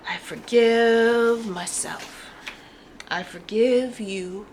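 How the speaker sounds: slow, deliberate forgiveness; earnest affirmation; slow sincere affirmation. Shown as a demonstration. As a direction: say it slowly and mean it. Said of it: "you stop and you go".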